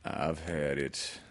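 low, slow, subdued donkey voice; dejected storybook donkey; gloomy donkey. A narrator's reading. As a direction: say it glumly and slowly.

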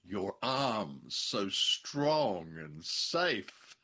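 very slow drawn-out strong and safe; slow breathy quote. Retold or imitated. Husband quoting the leading lady, slow and lingering. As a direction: linger on every word. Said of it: "leading lady gasps".

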